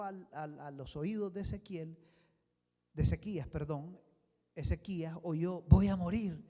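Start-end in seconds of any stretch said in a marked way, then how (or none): 5.6-6.4 anguished cry. Acted. Hezekiah hearing he will die.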